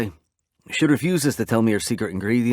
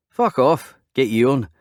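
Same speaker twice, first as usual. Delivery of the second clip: playful, higher and a touch louder; mock refusal; 4 semitones higher, 4 dB louder, slower than usual. A narrator's reading. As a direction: bat away his request for pie. Refuses pie to friend.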